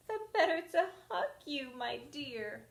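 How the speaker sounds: put-on high sweet old lady, wolfish underneath; fake granny voice. Wolf as Granny answers the girl, sweetly. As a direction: soothe her in the disguise.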